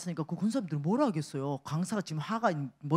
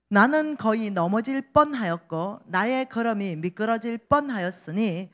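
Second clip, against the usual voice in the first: louder solemn Bible reading; scripture reading; 5 dB louder than usual. Acted. Reads scripture aloud. (힘있게 성경 봉독)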